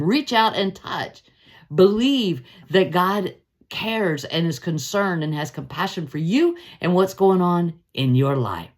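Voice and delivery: ordinary talk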